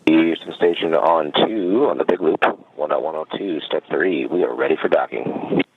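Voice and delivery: crackly radio transmission from orbit; breaking up space radio. Real, not acted. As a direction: speak through a distant, tinny radio link. Station calling over radio loop.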